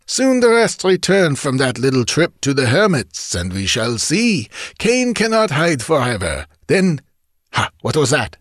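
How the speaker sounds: a chief plotting revenge, then startled; bandit chief's voice. Acted.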